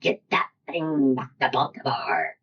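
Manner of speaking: slow, drawn-out movie-quote voice; slow mock-dramatic command; lady from Silence of the Lambs. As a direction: imitate a film line, slowly and deliberately. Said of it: "I channeled the lady from The Silence of the Lamb".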